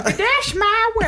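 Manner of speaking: put-on high, slow laughing old lady; grandma voice cracking with laughter. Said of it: "You sound like a grandma".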